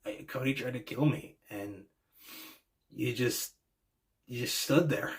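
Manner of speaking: retelling a promo softly, close to tears; imitating a choked-up plea, quiet and hurt; a hint of an upset friend on the verge of tears; near tears. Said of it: "he almost sounded like he was on the verge of tears".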